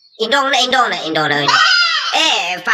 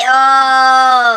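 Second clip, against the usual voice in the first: ordinary talk, nothing marked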